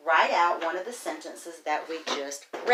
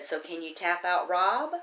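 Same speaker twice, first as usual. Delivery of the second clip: ordinary delivery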